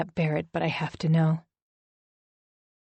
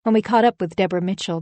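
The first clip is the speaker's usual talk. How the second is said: usual speaking voice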